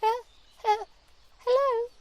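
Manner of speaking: very high, stammering little mouse voice; a timid mouse finding its first words; sweet shy mouse. Acted. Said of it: "said the mouse in a sweet, shy voice".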